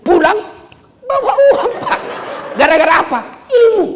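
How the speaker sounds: high, loud preacher's build to the word Ilmu; rousing excited shout. Acted. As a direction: fire up the crowd with a big build. Rousing punchline to the crowd.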